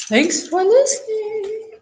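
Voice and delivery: slow, very high mock singsong; playful echoed sign-off. Co-host echoing the thanks before break, playful high sign-off.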